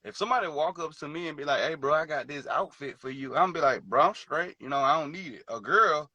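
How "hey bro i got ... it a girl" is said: usual speaking voice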